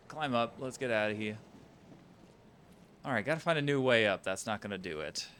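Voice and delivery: ordinary delivery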